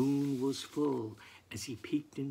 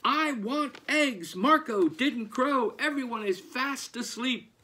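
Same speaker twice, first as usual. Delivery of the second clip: scolding farmer; 9 semitones higher, 4 dB louder than usual. Acted. Angry farmer scolds his hens.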